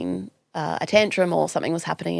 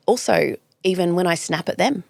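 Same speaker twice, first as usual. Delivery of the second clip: ordinary talk, nothing marked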